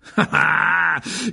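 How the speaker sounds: high, loud gloating laugh. Acted. Mr Weasley savours Malfoy's worry, gloating laugh. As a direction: laugh in triumph.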